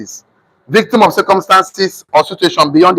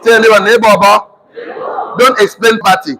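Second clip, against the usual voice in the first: rallying shout; 4 semitones higher, slower than usual. Acted. Rallying audience to repeat.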